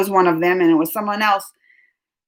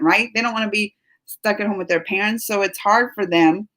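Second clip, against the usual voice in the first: ordinary talk, nothing marked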